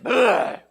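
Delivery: loud high groan of giving in; worn-down parent gives in to the kid, loud groan; exasperated groan. Retold or imitated. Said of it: "Till finally you just say".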